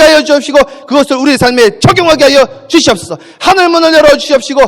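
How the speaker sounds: loud, driving preacher's prayer; fervent prayer. Real, not acted. Pastor leading the congregation in prayer, loud and fervent. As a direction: pray over the church at full force.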